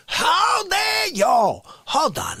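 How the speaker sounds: high slow shouted outrage. Acted. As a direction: bellow it in mock fury. Performed bit outburst.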